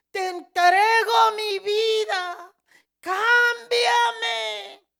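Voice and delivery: very loud, high, tearful wail; desperate plea. Retold or imitated. Woman begging Jesus to change her, desperate cry. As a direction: cry out in total surrender, drawing it out.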